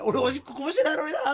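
high, loud self-quote with a laugh; high mock inner voice. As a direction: squeak out the silly inner thought. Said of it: "って、これあるあるじゃない？".

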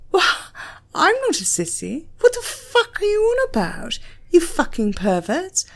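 he is seething and lets it all out; angry outburst. A narrator's reading. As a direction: snap back at the lecturer in outrage. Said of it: "feeling his blood boiling".

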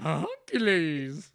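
loud, high, drawn-out silly voice; Hunkylees. Retold or imitated. Player imitating a favorite character voice, loud and silly. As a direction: belt out the name, laughing. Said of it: "I like Hunkylees' voices".

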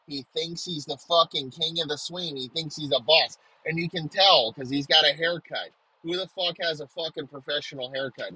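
ordinary delivery

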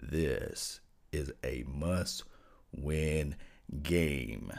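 deepest bass, very slow and drawn out; extremely deep bass voice. Shown as a demonstration. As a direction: sink into your lowest bass, dragging each word. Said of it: "I'm gonna get into my deepest voice I can let you guys hear".

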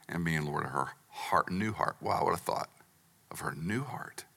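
hushed awe. Real, not acted. Awed recollection of patient.

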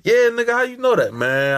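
high-pitched acted surprise; mock surprised reply. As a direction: answer the stranger high, playing it up. Acting out own reply to stranger.

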